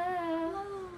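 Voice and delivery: eating chocolate. Real, not acted. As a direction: mouth full of chocolate. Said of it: "I have, like, chocolate in my mouth".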